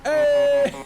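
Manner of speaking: sudden high, loud hey; excited shout. Acted. Calling out to the DJ, loud and high. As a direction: shout a greeting out. Excited greeting, big jump.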